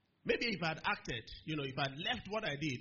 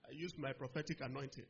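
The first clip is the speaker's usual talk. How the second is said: ordinary delivery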